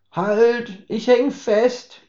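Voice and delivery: put-on high, slow drunk's cry; stuck and calling out, high and drawn out; drunk Herbert calling out. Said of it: "rief Herbert". (Herbert ruft betrunken, hoch und langgezogen)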